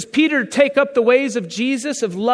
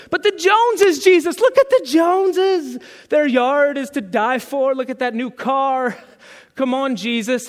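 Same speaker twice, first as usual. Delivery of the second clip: whiny believer. Retold or imitated. A believer pleading with Jesus about the Joneses, whiny.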